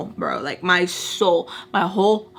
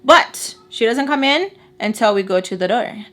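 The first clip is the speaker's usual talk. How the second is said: ordinary delivery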